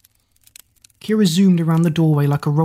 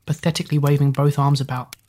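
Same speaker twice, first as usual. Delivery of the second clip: plain reading voice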